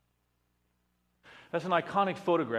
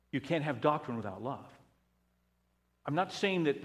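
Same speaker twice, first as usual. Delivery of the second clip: ordinary talk, nothing marked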